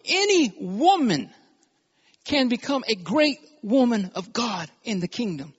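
ordinary delivery

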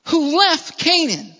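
high, emphatic preacher's wonder; amazement. Acted. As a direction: marvel loudly, stretching the key words. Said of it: "a wonder and an amazement".

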